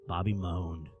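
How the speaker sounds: ordinary delivery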